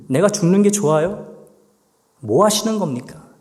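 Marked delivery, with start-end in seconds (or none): none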